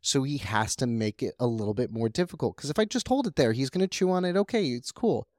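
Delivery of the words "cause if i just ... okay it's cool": high, excited chatter; rising into squeaky excitement; excited, high-pitched. Real, not acted. Excited bunny play story.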